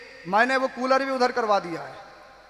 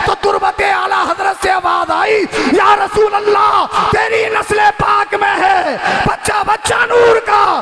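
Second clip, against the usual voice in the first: preaching at full force; 7 semitones higher, 7 dB louder than usual. Acted. Preacher reciting Ala Hazrat's verse to the crowd, full-force chanting. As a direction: cry the verse out to the hall.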